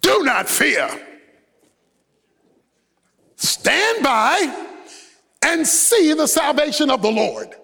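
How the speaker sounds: a lightly voiced pastor proclaiming; pulpit pastor. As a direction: lift the voice as from the pulpit. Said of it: "The pastor mounts the pulpit and says".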